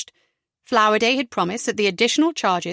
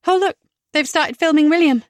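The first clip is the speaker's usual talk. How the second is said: higher and louder, pointing it out; bright excited exclamation; 7 semitones higher, 6 dB louder than usual. A narrator's reading. Rebecca excitedly points out filming.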